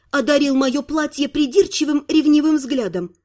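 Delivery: ordinary delivery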